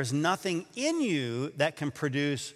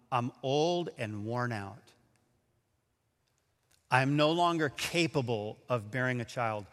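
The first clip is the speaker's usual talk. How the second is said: weary Sarah; 5 semitones lower, 5 dB quieter than usual. Acted. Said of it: "she says".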